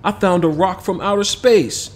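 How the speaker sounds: put-on a kid thrilled by his find; boy planning to post the space rock online, excited; excited boy Kamal. Narrator voices boy Kamal.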